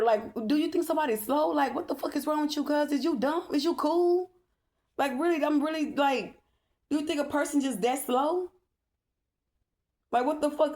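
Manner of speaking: high-pitched, incredulous scolding, voice way up; exasperated rant. Real, not acted.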